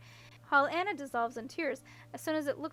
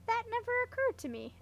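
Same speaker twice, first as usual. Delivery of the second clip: a sudden lift of surprise; struck with surprise; 11 semitones higher, 4 dB louder than usual. A narrator's reading. Princess hearing a new idea, surprised. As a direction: caught off guard, high and bright. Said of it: "much struck".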